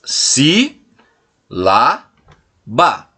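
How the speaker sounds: "si, lá, ba" as separate beats with pauses; syllable by syllable. Shown as a demonstration. Teacher lists the word's syllables for pupils, "si, lá, ba" each isolated. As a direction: leave a clear gap after each of "si, lá, ba". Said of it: "ela é composta pelas sílabas".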